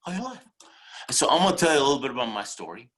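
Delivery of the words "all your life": high, soft questioning echo; echoed warning. Real, not acted. Speaker pressing warning on audience, high soft echo. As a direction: lift it high and quiet at the end.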